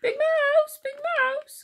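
very high, loud mouse squeal; calling Little Mouse. A narrator's reading. Little Mouse calls sleeping Big Mouse, high alarmed squeak. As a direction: call out high and drawn-out in alarm. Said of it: "called Little Mouse".

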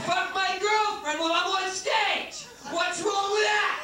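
high, drawn-out laughing shout; high mocking shout. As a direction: yell the catchphrase up high and slow. Escalating mocking catchphrase.

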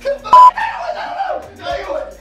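high, loud disbelieving yell; excited shout. Real, not acted. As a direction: blurt the name in shock.

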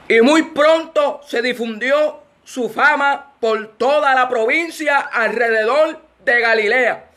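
slow, solemn Bible reading; scripture reading. Real, not acted. Preacher reads the demon-in-the-temple passage, slow scripture reading. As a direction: draw out each phrase and pause between them.

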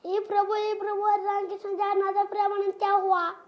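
loud, rapid mock plea to God; dramatic reel dialogue. Shown as a demonstration. Child reciting a reel line on request, loud dramatic recital. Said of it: "అది చెప్పు".

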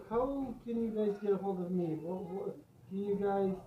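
normal talking voice

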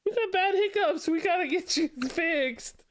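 high cooing voice with a laugh. Real, not acted. Talking to the baby.

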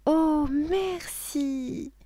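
high, very drawn-out thanks; high child voice, slow and savouring; delighted little girl. Acted. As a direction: stretch it out as a thrilled child. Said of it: "dit Lola en se régalant".

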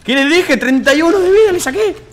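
high, very loud boast; triumphant yelling. Real, not acted. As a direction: yell it as proof you were right. Bragging about damage dealt. (grito triunfal de fanfarrón)

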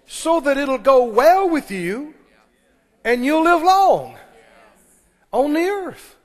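high, loud, slow scripture promise; preaching at full force. Acted. Preacher proclaims the promise to his congregation, loud and slow. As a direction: lift it up and proclaim slowly.

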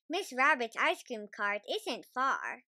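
plain reading voice

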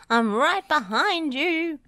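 high, loud, stretched character voice; big drawn-out cartoon call; Rolly calling out. A narrator's reading. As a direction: sing it out slowly and loudly. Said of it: "rumbled Rolly".

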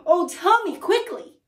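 high, loud, urgent begging; crying out. A narrator's reading. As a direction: plead as if time is running out. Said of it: "she cried".

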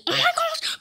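high, squealed alarm; play-acted alarm. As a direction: squeal it in mock panic. Panic at the zombie.

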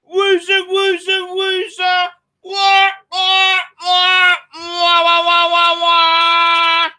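high sing-song taunt into wailing wahs; mock crybaby. Host mocks Clive's wimpy friends, hammed-up baby wailing. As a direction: taunt them like a bawling baby.

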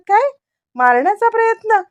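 high, startled disbelief; shocked father repeats her words up high; surprised. A narrator's reading. Father hears someone tried to kill his wife, surprised. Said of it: "रविराजांना थोडं आश्चर्यच वाटतं".

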